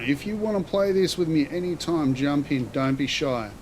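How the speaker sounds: ordinary talk, nothing marked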